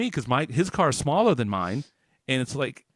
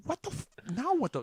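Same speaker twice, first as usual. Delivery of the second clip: retold shock; 14 semitones higher, 3 dB quieter than usual. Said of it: "I was like".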